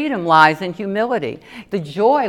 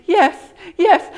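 much higher, louder tearful yes; overwhelmed yes; 12 semitones higher, 5 dB louder than usual. Retold or imitated. Woman at the altar answers, higher and louder. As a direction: insist you're fine through tears. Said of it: "And I'm going".

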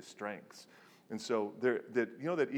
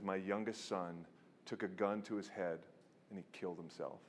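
hushed, lower, with heavy pauses; retold grief, quietly restrained; grave confession; 3 semitones lower, 6 dB quieter than usual. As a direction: let it sink softly to the end. Retold confession of son's suicide.

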